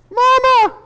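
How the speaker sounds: put-on very high, loud toddler call; mock toddler's high-pitched holler; child calling mama. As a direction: shout for mama like a little kid. Acting out small child calling mother.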